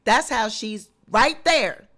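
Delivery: ordinary talk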